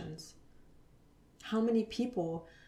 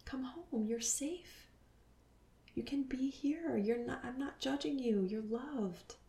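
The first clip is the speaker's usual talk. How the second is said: soft, half-whispered and higher, tender; calling herself back; 5 semitones higher, 3 dB quieter than usual. Retold or imitated. To herself lost in spirit world, whispered tender reassurance. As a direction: hush and soothe a frightened part of yourself. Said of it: "I would just call myself back".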